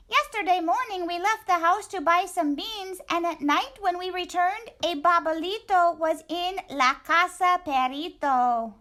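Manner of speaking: a tiny excited dog greeting his friend; squeaky little dog. Acted. Poquito Tito greeting Scapito, high squeaky voice.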